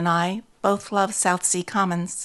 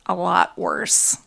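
plain reading voice

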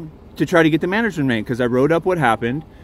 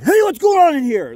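sudden high, loud challenge; mock guard yell; 11 semitones higher, 3 dB louder than usual. Retelling the park guard barging in, mock yell. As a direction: bark it like a guard bursting in. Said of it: "and yelled".

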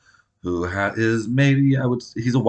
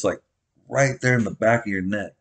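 ordinary talk, nothing marked

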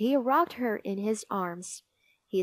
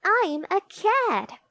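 put-on high, proud child's announcement; seruan anak; 10 semitones higher, 3 dB louder than usual. A narrator's reading. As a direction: announce it brightly to the baby, pitched way up. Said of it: "she said".

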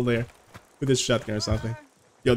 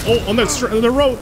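excited callout; 10 semitones higher, 6 dB louder, much faster than usual. Real, not acted. Spotting enemy callout.

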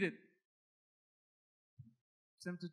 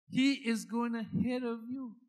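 usual speaking voice

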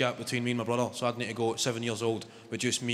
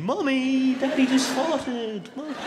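put-on very high, loud, drawn-out child voice; whining kid's accent; 14 semitones higher, 6 dB louder, slower than usual. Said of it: "It's that accent again".

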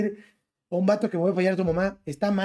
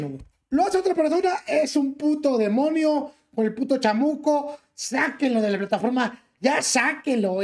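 higher, mocking voice of a two-faced streamer; mocking a hypocrite; 4 semitones higher than usual. Streamer to viewers, parodying two-faced Twitch users with mock outrage. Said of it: "la plataforma más hipócrita del mundo".

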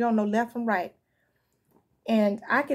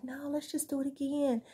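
coaxing tempter; 5 semitones higher, 6 dB quieter than usual. Retold or imitated. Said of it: "keep coming to them like".

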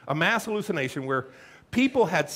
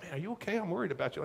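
softer, worried friend; concerned friend; 10 dB quieter, much faster than usual. Retold or imitated. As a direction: ask gently, quietly worried. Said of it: "you're like".

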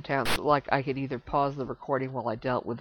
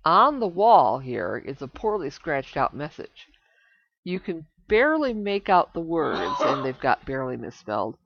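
reading game text; 7 dB louder than usual. Acted. Reads game text aloud.

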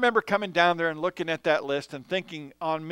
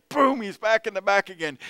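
ordinary delivery

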